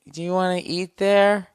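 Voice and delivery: put-on high, loud sad girlfriend voice; mock-pitiful girl asking, eyes down. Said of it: "she's like".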